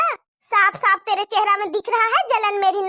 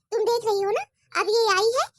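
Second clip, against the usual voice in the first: ordinary talk, nothing marked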